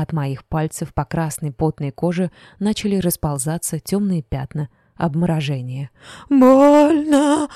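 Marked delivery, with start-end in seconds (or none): 6.3-7.5 very high, very loud howl; howling in pain. A narrator's reading. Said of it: "вопил пьяница".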